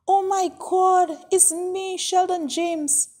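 put-on overjoyed shock at meeting an old friend; astonished recognition. A narrator's reading. Father recognises old school friend.

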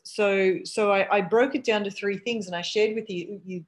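talking while chewing; words round a bite of food; mouth full. Real, not acted. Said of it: "excuse me as I eat with my mouth full".

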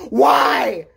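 loud, exasperated mom yelling why; fed-up mom bellowing at her kid; mother's exasperated shout. Retold or imitated. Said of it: "the one word I heard from my mother".

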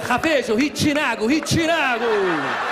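Farsi words said in a hammed-up Italian accent, slow; mock Italian accent. Friend showing off to the girls in a mock Italian accent. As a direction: drag out he chinago like a fake Italian. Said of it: "Farsi with an Italian accent".

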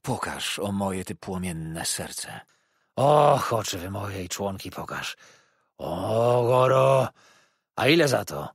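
moan, then burst out like howling wind; howling old man. A narrator's reading. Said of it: "wycia wiatru przechodzącego z minorowych do majorowych tonów".

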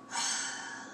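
long, soft exhaled sigh; stressed sigh. Acted. Stressed person with aching shoulders and neck, sighing wearily. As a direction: let the whole day out in one breath. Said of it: "when you're so stressed".

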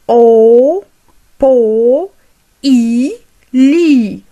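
"O, po, i, li" as loud, held, separated sounds. Shown as a demonstration. Teacher drilling learners on vowels, loud separated sounds "O, po, i, li". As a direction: build the word from "O", "po", "i", "li", one at a time.

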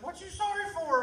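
very high, loud, urgent question; retold cry of baffled alarm; bewildered husband. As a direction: imitate an alarmed husband demanding an explanation. Retold husband baffled by wife's apology.

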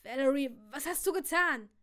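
loud shocked accusation; angry accusation. A narrator's reading. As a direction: confront her angrily. Husband confronts wife.

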